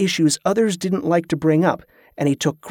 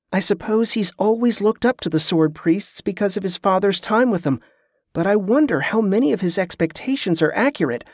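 higher-pitched, pensive inner voice; higher, thoughtful character voice; musing Kerla; 4 semitones higher than usual. Acted. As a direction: wonder to yourself with sympathy.

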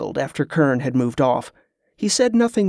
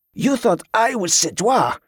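put-on high-pitched, French-accented outrage; indignant outburst; 7 semitones higher than usual. A narrator's reading. Pirate rebukes the captain for suspecting him, indignant outburst. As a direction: throw the accusation back, affronted. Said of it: "from the outburst".

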